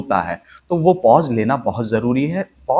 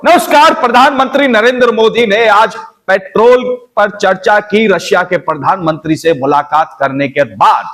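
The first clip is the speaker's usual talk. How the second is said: much higher, loud anchor voice; a different anchor, shrill-free but pitched way up; another newsreader; 8 semitones higher, 7 dB louder than usual. Shown as a demonstration. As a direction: another TV newsreader with his own rhythm. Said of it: "अब वही कोई और".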